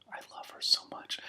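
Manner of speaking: breathy whispered aside. Real, not acted. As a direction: drop to a secret whisper.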